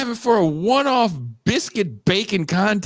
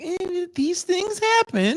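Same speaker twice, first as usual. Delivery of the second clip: mock shrug, higher and slow; 9 semitones higher than usual. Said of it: "And Tucson is like".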